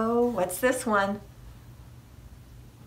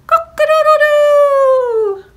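loud, high, long-held cock-a-doodle-doo; rooster crow; 14 dB louder, slower than usual. A narrator's reading. Rooster waking the farmer at sunrise, crowing loudly. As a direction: crow at full volume like a rooster. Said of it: "the rooster crowed".

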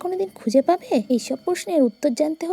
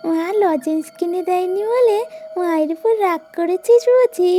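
coaxing madwoman; 3 semitones higher than usual. Acted. Madwoman coaxing a strange child she thinks is hers, sweetly. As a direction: lure the child in like a doting mother.

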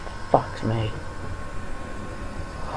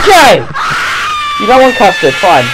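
loud high yell over screaming; loud, rattled concession; frightened shouting; 9 semitones higher, 7 dB louder than usual. Real, not acted. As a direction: shout back in panic. Shouting back at screaming creature.

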